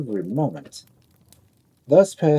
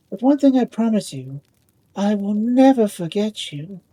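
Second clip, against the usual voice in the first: lightly voiced gentle ball, higher; 8 semitones higher than usual. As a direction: let him down kindly. Ball refuses the top.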